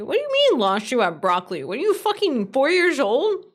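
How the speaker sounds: sudden high mock-indignant snap; mock aggressive outburst. Streamer snapping at chat over broccoli in mock aggression. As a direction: jokingly bite back at a chatter. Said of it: "that was unnecessarily aggressive".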